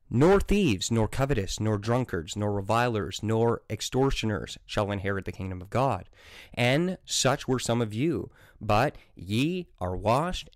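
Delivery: measured King James recitation; scripture reading. Acted. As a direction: read it like a preacher at the pulpit. Reads the verse aloud.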